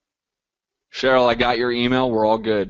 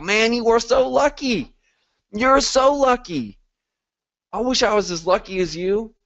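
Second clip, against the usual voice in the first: envious friend; 8 semitones higher than usual. Pretend. Coach mimicking envious friends, high and gushing. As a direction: coo it like a jealous friend in awe. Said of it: "Let your friends and family talk about".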